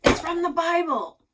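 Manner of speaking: loud, grumpy snap from Grandpa; irritated grandfather, loud and sharp. Acted. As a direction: bark it out like an annoyed old man. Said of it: "Grandpa grumped".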